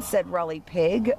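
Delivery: usual speaking voice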